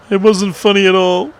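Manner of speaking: high, silly character voice; put-on scolding voice. Streamer heckling his own failed joke, high put-on voice. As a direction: pitch it way up in mock rebuke.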